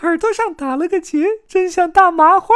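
very high squeaky voice poking fun; 憋笑的小伙伴. A narrator's reading. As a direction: tease in a tiny, high-pitched character voice. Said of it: "使劲捂着嘴，不让自己笑出声来". (尖细嗓音、忍着笑打趣)

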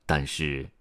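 ordinary delivery

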